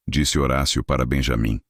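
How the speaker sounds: usual speaking voice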